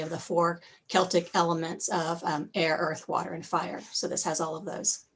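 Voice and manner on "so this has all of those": ordinary talk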